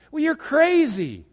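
mock incredulous. Critic mocking.

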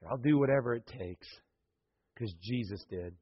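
hushed heartfelt close; quiet sincere testimony. Real, not acted. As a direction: bring it down to an intimate confession. Quiet closing reflection.

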